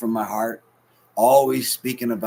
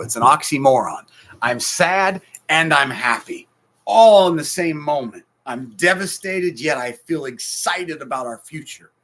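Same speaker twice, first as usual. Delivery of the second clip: louder, higher, slowed, swelling; impassioned preaching; 5 semitones higher, 7 dB louder than usual. Real, not acted. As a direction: pour out the heartbreak and hope. Preaching mixed emotions.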